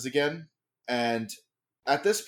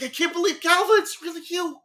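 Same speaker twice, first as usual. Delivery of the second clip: much higher, gushing with joy; excited, not yelling; 6 dB louder, faster than usual. Acted. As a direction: squeal with happy disbelief. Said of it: "He's not yelling it, but he's just like".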